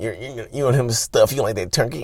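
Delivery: silly put-on accusing voice; hammed-up mock accusation; stupid mocking voice. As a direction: mimic your own daft jealous-partner act. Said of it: "this stupid voice I use".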